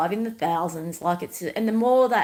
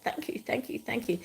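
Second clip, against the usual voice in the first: whispered thanks; 3 semitones higher, 8 dB quieter than usual. Retold or imitated. Stranger's grateful reply.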